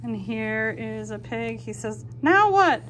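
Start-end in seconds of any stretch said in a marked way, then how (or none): 2.2-2.8 high and loud quick question; eager pig voice. Acted. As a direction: ask impatiently what comes next. Pig asks what next.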